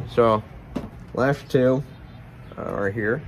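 talking around pipe stem; pipe in mouth. Real, not acted. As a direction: teeth clenched on pipe. Seen 3: Pipe stem clenched in teeth from -1.0 to 3.4, then removed.

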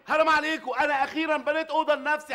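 high, loud exasperated plea; shouting protest at the family. Acted. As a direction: cry out against them all. Adel protesting family's claims.

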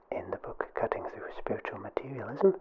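ordinary talk

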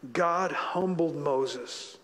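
ordinary talk

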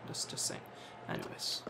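under the breath whisper; whispered aside. Real, not acted. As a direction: mutter it to yourself. Muttering after a worry.

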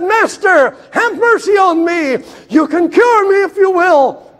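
high, loud cry for mercy; desperate plea. Acted. Leper crying to the Master, loud and desperate. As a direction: beg at the top of your voice.

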